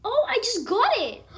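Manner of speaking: high, loud thrilled shriek; excited shriek. Real, not acted. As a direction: shriek with delight at the catch. She wins a catch.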